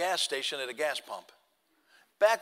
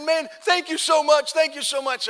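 loud, higher, overjoyed gushing; so excited; 9 semitones higher, 6 dB louder than usual. Retold or imitated. First-time hunter running over after his first kill, loud overjoyed. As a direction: burst with a first hunter's thrill. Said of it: "he was so excited".

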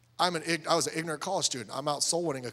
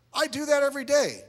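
imitated guy's answer, pitched high and loud; glib reply; 12 semitones higher, 5 dB louder than usual. Witnessed guy answering the soul winner, glib. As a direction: toss it off high and loud, as a quote.

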